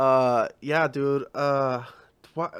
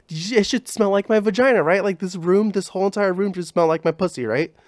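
excited; 7 semitones higher, 5 dB louder than usual. Retold or imitated. Gwyneth pitches her candle idea.

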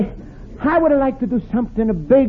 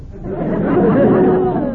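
chuckle; 8 semitones higher than usual. Acted. Luigi laughs at the gum joke.

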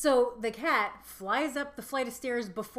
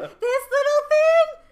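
much higher, louder, slow mocking baby talk; taunting neighbour; 14 dB louder, much slower than usual. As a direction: dangle the cat and tease. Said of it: "taunting me with it".

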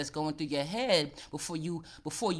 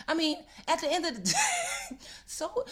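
laughing it off, pitched up high; 13 semitones higher than usual. Real, not acted. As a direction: laugh through the words, shrugging it off. Laughing off the embarrassment.

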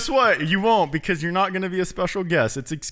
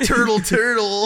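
turtle voice; 5 semitones higher, 3 dB louder, slower than usual. Pretend. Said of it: "a turtle accent".

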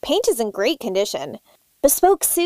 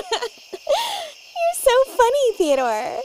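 high giggle then fond tease; flirty giggle; 14 semitones higher than usual. Acted. Guest giggling at the ghost sailor, high and flirty.

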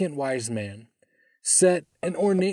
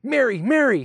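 high, loud, joyful call; delighted shout of a name; happy shout; 9 semitones higher, 5 dB louder than usual. A narrator's reading. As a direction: call out to your wife happily. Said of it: "he happily shouted".

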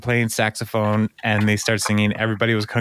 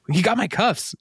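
imitating officer; 10 semitones higher than usual. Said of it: "the officer's like".

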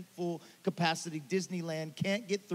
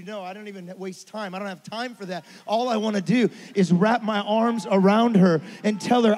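ordinary talk

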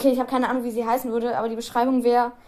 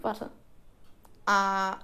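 ordinary delivery

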